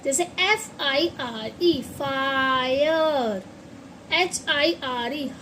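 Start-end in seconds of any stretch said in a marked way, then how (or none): 1.8-3.4 फायर stretched out very slowly, aa-yer; very slow fire. Shown as a demonstration. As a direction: draw फायर out syllable by syllable. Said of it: "इसे हम फायर".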